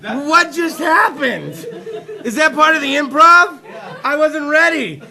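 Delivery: exclaimed, indignant bafflement; baffled protest. Real, not acted. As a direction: protest loudly, caught off guard. Comic protest after improv.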